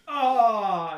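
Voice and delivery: long, loud, high falling death cry; death wail. Retold or imitated. Vampire is shot and falls down, hammy death wail. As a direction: overact a dying monster's wail.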